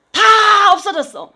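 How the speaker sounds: preacher hammering home that everything was gone, loud; emphatic exclamation. Real, not acted. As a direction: stretch each word out to hit it home.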